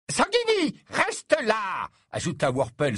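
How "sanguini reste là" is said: high, sharp call to order; suddenly stern. A narrator's reading. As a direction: call him back sternly, pitch jumping up. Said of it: "soudain sévère".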